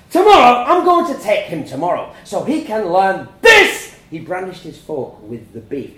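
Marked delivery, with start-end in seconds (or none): 0.1-3.9 very loud, high shouting; furious shout. A narrator's reading. Parents shouting at each other over the child. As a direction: blast it across the table.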